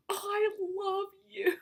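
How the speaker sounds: high, drawn-out squeal of love; excited gushing. Retold or imitated. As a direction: gush it loud and high, stretching every word. Retold excited greeting to idol.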